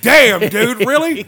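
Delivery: high, loud shocked "really"; mock shocked reaction. Audience member stunned by the rant, loud mock disbelief.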